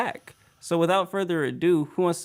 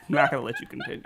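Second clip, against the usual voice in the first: laughing; much faster than usual. Real, not acted. Host laughing at S0's noise.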